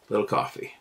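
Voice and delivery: whispered little aside; quiet whispered coffee remark; hushed aside. Real, not acted. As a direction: breathe it almost unvoiced. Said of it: "I'll slow down".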